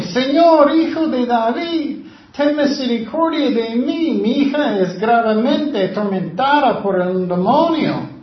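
loud desperate plea, high and loud; crying out. Acted. Mother crying out to Jesus for her daughter, loud plea. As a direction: cry out to Jesus for help. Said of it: "Ella estaba gritando a Jesucristo".

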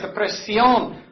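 normal talking voice

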